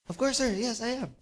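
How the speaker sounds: lightly acted confidence; self-assured photographer. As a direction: answer the boss without a doubt. Last photographer answers confidently.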